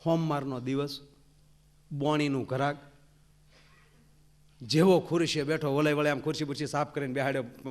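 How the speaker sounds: normal talking voice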